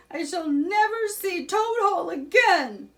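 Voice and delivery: high, loud despairing wail; wailing sob. A narrator's reading. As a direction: mourn the lost home in tears. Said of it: "Toad sobbed".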